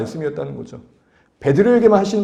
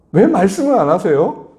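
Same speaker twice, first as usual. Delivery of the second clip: higher, louder, impatient plea; impatient demand; 6 semitones higher, 4 dB louder than usual. Acted. A believer pressing the silent Lord, impatient and loud. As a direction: press for an answer that won't come.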